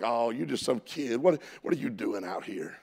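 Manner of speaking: low, soft dismissive jab; mocking older brothers. Preacher as brothers mocking young David, low dismissive mockery. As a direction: belittle him, low and offhand. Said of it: "they mock him because they're like".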